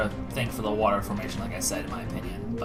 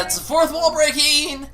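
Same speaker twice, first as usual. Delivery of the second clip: excited; 14 semitones higher, 6 dB louder, slower than usual. Real, not acted. Excited reaction to game dialogue.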